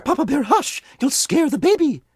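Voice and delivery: high motherly bear, gently puzzled; Mama Bear answering Papa Bear about the soup. Acted.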